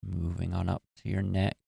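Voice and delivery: calm, even meditation voice with long pauses; guided meditation. Acted. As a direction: guide listeners gently through relaxing the body. Leads a guided body-relaxation meditation.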